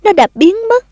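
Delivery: high-pitched cry of dismay; horrified realization. A narrator's reading. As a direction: let the voice leap up in horror. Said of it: "Tôi kinh hoàng nhận ra".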